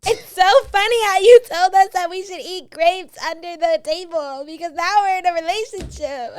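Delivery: high, loud, put-on voice of her friend; reenacting how her friend broke the news, high put-on voice; imitating her friend. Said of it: "gonna reenact the whole thing".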